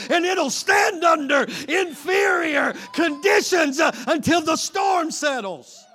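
loud, high, drawn-out preacher's cry; full-force preaching. Acted. Preacher crying out to the congregation at full force. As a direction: preach it out at the top of the voice.

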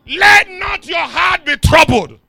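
loud scripture proclamation, high at the start; full-force preaching. Real, not acted. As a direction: proclaim it, then press the congregation with the question.

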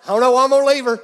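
high, very loud Southern holler. Acted. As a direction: shout it in a put-on Southern accent. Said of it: "He may not be Southern".

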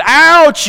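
high, loud yelp of "Ouch" as if stubbing a toe; mock ouch. Preacher acting out stubbing his toe on a chair, yelp. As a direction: yelp it like you just jammed your toe. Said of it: "toe hits that chair".

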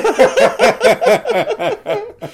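very high burst of laughter. Real, not acted. Laughs at big family.

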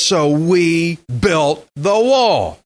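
"So we built the wall" very slowly, each word weighted; strong emphasis. Shown as a demonstration. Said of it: "look at that emphasis there".

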